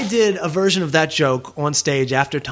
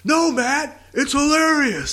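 mock heckling yell, much higher pitch; Tom Wilson freaking out; 9 semitones higher, slower than usual. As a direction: imitate an outraged heckler, high and drawn out. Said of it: "He freaked out".

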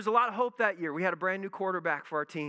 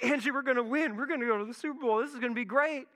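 higher, fast, giddy announcement; excited to wife; 6 semitones higher, faster than usual. Retold or imitated. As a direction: tell your wife the team is going to win, fast and thrilled. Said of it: "I'm like".

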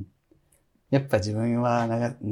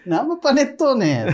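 high-pitched, louder character voice; Kayo impression; 12 semitones higher, 5 dB louder than usual. Co-host teases about a cake name, high-pitched impression. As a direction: call out the name in a high put-on voice. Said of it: "かよさん言ってそうやもん".

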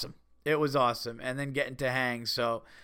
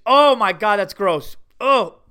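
loud, high grossed-out cry; disgusted outburst; 5 semitones higher, 10 dB louder than usual. Real, not acted. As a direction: recoil loudly from something gross. Reacting to gross picture.

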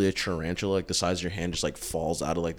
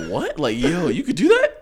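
surprised kangaroo; 9 semitones higher, 6 dB louder than usual. Pretend. Kangaroo voiced in a story.

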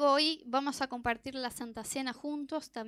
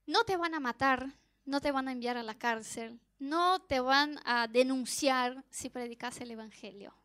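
ordinary talk

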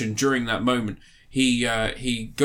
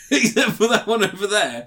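laughing; 8 semitones higher, 4 dB louder than usual. Real, not acted. Teasing about figure while laughing.

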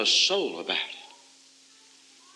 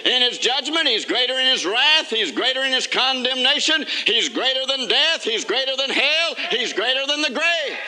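preaching at full force; 6 semitones higher than usual. Acted. Preacher to his congregation, building a rousing litany. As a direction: preach it, build each "He's greater" toward the climax.

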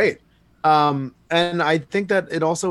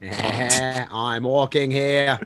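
very slow, exaggerated Boston-tinged Australian accent; JFK-Australian voice; much slower than usual. Repeating a line on request, drawn-out JFK-like Australian accent. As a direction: stretch each word in a mock Kennedy-Australian accent. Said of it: "Can you say that voice and say".

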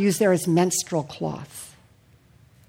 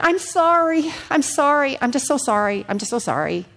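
mock anxious sorry, higher then fading; pleading apology; 6 semitones higher than usual. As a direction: repeat the apology, sinking each time. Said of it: "I would say continually to the Lord".

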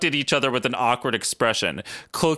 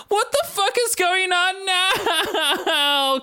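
high, slow, breaking with laughter; laugh-crying; much slower than usual. Real, not acted. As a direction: squeal it through helpless laughter. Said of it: "Katie literally cried".

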